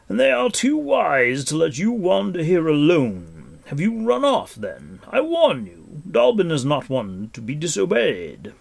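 loud, high, declamatory warning; commanding Gwydion. A narrator's reading. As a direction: lecture the boy with lordly force. Gwydion lectures and warns the boy.

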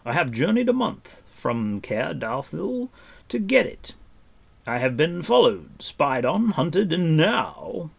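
high, loud, mounting grievance; frustrated recounting. A narrator's reading. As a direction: build through each hardship, dragging the last. Gwydion recounts his thwarted mission.

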